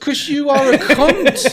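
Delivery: laughing retold put-down; laughing punchline. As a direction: land the insult while cracking up.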